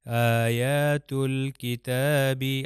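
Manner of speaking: آیات الکتابی slowly chanted, lam clearly sounded; Quran recitation. Shown as a demonstration. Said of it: "میں لام کو ضرور تلفظ کروں گا".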